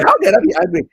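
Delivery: incredulous. Real, not acted. Laughing off critics' accusation.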